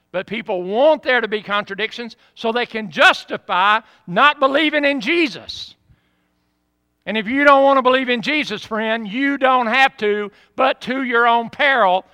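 loud, high pulpit warning; forceful preaching. Real, not acted. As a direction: preach it hard at the doubters.

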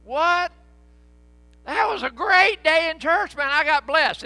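high, loud, outraged protest; indignant surprise. Retold or imitated. Churchgoer retorting to spouse in the car: loud indignant surprise. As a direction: act stunned anyone disliked church. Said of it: "And you go".

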